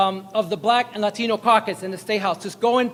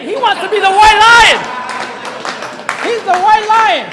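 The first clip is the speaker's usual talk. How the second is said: much higher, loud rally cry; excited shout; 11 semitones higher, 5 dB louder than usual. Real, not acted. Crowd hype man shouting out the governor's nickname.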